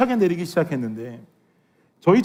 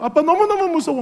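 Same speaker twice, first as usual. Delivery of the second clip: higher still, pleading 아빠 너무너무 무서워; scared child; 9 semitones higher than usual. Retold or imitated. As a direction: call to daddy, more desperate. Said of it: "막 그러면서 계속 우는 거예요". (아빠를 부르며 더 애처롭게 우는 아이 흉내)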